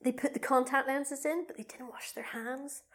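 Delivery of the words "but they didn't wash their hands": whisper. Real, not acted. Gross-out secret detail.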